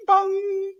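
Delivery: a high ringing bong like a PA chime; imitated chime bong. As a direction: ring it out like the ship's chime. Imitating ship announcement chime.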